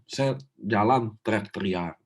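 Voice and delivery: usual speaking voice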